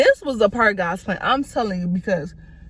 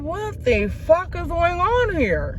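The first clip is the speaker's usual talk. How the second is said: slow and higher, stunned; slow stunned disbelief; 6 semitones higher, slower than usual. Retold or imitated. Retelling her shock at the late package, slow and higher. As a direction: drag out the confusion, higher pitched.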